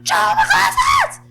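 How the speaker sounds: high, loud, desperate scream; screaming for Joe. Retold or imitated. Yelling to Joe in the surf after losing her glasses, a loud desperate scream. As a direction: scream his name across the waves. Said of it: "And I just started screaming".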